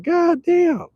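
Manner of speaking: high put-on voice imitating a grumpy local; mock Detroit local, high voice. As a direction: squeal it like a shocked stranger.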